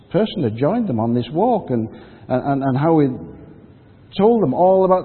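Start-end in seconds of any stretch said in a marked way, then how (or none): none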